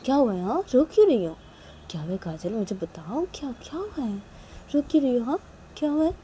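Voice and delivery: high-pitched questioning with rising-falling swoops; a mother anxiously pressing her child for answers; concerned mother. Acted. (परेशान माँ की ऊँची, सवालिया आवाज़)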